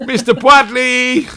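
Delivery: high slow drawn-out call; mock formal greeting. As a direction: announce him grandly.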